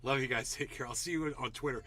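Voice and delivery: ordinary talk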